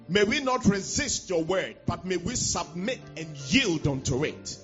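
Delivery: usual speaking voice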